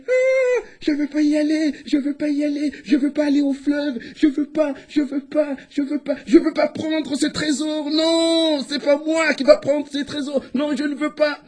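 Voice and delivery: high, wailing protest on one pitch; crying out. Acted. Hare in the sack cries out, high wailing protest. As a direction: cry out in high, repeated refusals. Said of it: "s'est mis à crier".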